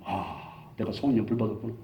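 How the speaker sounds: low, soft musing to himself; retold quiet realization. Preacher echoing friend's words to himself, quiet realization. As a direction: let it sink in, hushed and low. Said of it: "그 말이 지금도 쟁쟁하게".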